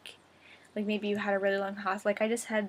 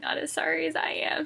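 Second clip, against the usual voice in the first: retold rueful reply; 8 semitones higher, 4 dB louder than usual. Retold joking reply to doctor.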